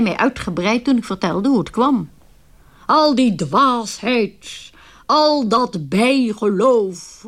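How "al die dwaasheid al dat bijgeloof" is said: put-on very slow, stern scolding of a child; stern gentleman. Gentleman scolding the child who ran into him, stern and slow. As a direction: draw out each word like a strict elder. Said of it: "riep hij streng".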